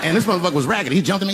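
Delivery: ordinary talk, nothing marked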